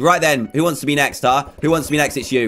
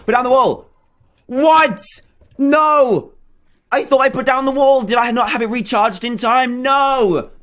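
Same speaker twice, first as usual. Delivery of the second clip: shocked gamer protest, pitched way up; dismayed disbelief; 8 semitones higher than usual. Real, not acted. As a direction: cry out as the plan collapses. Wall fails, he loses.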